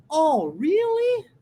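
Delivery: slow, drawn-out surprised question; amazed, lingering rise on really. Shown as a demonstration. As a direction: stretch it out in astonishment, pitch swinging up. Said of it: "num sinal de espanto".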